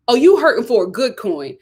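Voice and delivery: ordinary talk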